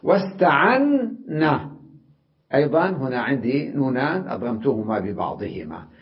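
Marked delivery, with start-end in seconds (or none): none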